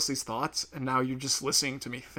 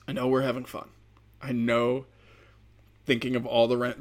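normal talking voice

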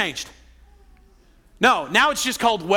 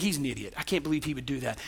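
softer, low scornful mutter; resentful thought; 8 semitones lower, 9 dB quieter, faster than usual. Retold or imitated. Resentful spouse thinking about partner, low bitter mutter. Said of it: "You begin to think".

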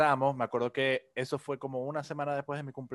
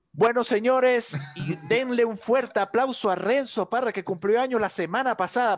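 mock pastor announcement; 9 semitones higher, 6 dB louder than usual. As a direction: boom it out like a pastor on the mic.

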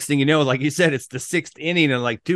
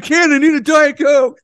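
much higher, louder put-on whiny voice; mocking whine; 9 dB louder than usual. Friend teasing the host about his soda, mocking whine. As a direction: whine it like a spoiled kid.

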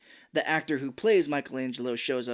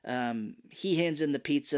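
ordinary talk

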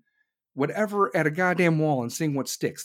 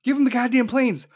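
louder and higher, hammering the demand; emphatic demand; 6 semitones higher, 5 dB louder than usual. Real, not acted. Comic demanding the crowd back Ukraine, loud. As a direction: bang the table for the planes.

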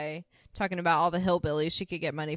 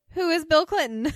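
incredulous; 9 semitones higher, 7 dB louder than usual. Retold or imitated. Suzanne baffled by name.

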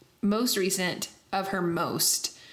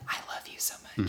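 hushed, quick fan whisper; soft, breathless mock adoration; adoring whisper; 11 semitones lower, 7 dB quieter, faster than usual. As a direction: whisper it in awe to your idol. Retelling awed reaction to the singer.

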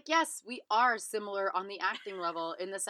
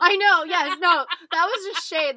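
loud, high-pitched defensive protest; excited protest; 8 semitones higher, 11 dB louder than usual. Real, not acted. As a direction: laugh it off, loudly defensive. Defending a joke, laughing.

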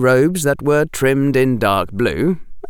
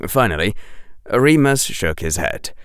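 ordinary delivery

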